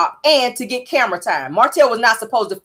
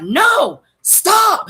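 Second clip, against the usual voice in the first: mock yell; 10 semitones higher than usual. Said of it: "Martell then yells out".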